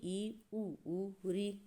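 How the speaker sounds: ई and ऊ said softly with the mouth nearly shut; showing students the closed vowels ई and ऊ; closed vowel demo. Shown as a demonstration. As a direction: sound out ई, ऊ, ऊ with lips barely open. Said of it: "कितना मुंह खोलना पड़ा?".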